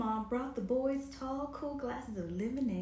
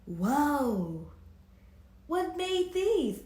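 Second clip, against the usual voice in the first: loud, slow whoa of wonder; loud slow astonished question; amazed; 4 semitones higher, 6 dB louder, much slower than usual. A narrator's reading. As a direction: gasp at the giant tracks. Boys discover huge tracks.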